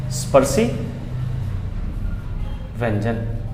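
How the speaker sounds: a teacher spells out the new term word by word. Shown as a demonstration. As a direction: drop your voice and space out स्पर्शी … व्यंजन for the class to note.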